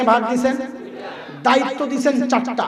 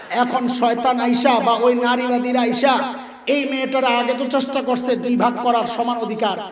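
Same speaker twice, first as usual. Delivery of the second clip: ordinary talk, nothing marked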